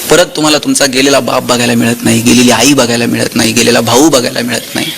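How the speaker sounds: low, subdued and grave; hushed-pitch, evenly measured sorrow; solemn. Real, not acted. Speaker solemnly evokes lost family members.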